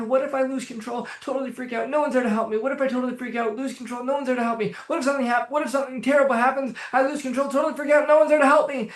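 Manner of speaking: high, racing worry on repeat; acted panicky spiral; anxious inner voice. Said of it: "in this tone of voice on repeat".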